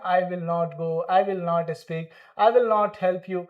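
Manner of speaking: "will not" as two separate full words; old full form. Shown as a demonstration. Teacher recalls how students used to say it, uncontracted. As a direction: recite each "will not" fully and evenly. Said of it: "पहले बोलते थे ना".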